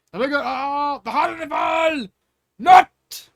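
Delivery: high, loud gloating shout; triumphant taunt. Acted. King taunting the giant monster, loud gloating. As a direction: crow it over the beast.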